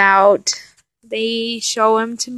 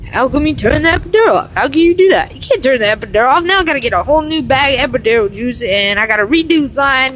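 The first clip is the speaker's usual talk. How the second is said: fast, higher, louder angry rant at the nurse; retold yelling; 4 semitones higher, 4 dB louder, faster than usual. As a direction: imitate him snapping at the nurse, rushed and raised. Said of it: "he's yelling at the nurse".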